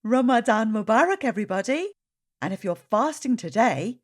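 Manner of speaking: bright storytime host welcome; friendly children's presenter; reading to children. Acted. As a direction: greet the little listeners cheerfully.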